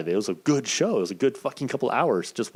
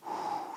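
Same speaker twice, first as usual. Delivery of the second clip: soft, slow calming exhale; focused breath out; 12 dB quieter than usual. Shown as a demonstration. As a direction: blow out a quiet yoga breath. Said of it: "trying to focus my breathing".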